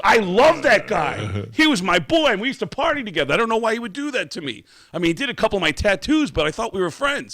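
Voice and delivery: high, loud, fast wounded rant; hurt drummer. Retold or imitated. Drummer telling his band about the tattoo artist, high and hurt. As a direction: rattle it off high like a betrayed friend. Drummer voiced in retold story.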